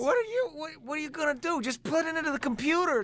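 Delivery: high, incredulous complaint; high exasperated retelling to the clerk. Retold or imitated. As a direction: raise the pitch in disbelief at him. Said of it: "And I'm like".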